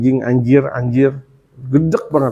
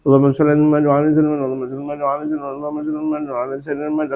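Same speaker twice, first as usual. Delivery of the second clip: fast salawat fading from normal to much softer; rapid salawat; 5 dB quieter, much faster than usual. Shown as a demonstration. Preacher demonstrates constant salawat, fast and dropping away. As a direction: rattle off the blessing quickly and let it sink to a murmur.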